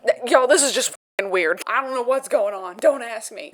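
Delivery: loud, exasperated newscaster rant; a reporter losing it on air; flustered news reporter. Pretend. Newsreader baffled by the owls, loud and exasperated. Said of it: "P O V, I'm the news reporter".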